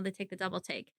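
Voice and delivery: ordinary talk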